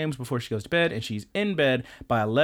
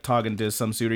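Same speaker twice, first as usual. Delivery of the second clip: ordinary talk, nothing marked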